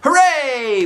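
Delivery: loud high cheer; high-pitched shouted hooray; shouting hooray. A narrator's reading. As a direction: cheer it like a kid who won. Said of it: "we shouted".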